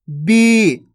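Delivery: "बी" with a long, held ee; drawn-out vowel. Shown as a demonstration. Teacher answering for pupils, drawn-out "बी". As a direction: stretch the ee of "बी". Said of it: "खींच खींच के उच्चारण करना है".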